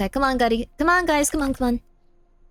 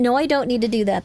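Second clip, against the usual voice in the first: normal talking voice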